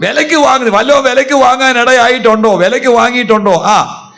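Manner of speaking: high, loud fervent sermon; fervent pulpit preaching. Real, not acted. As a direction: preach at full force. Full-force preaching.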